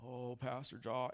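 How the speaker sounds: slow, mopey droning lament; lightly voiced Eeyore complaint. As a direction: moan like a gloomy donkey. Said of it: "how Eeyore would talk".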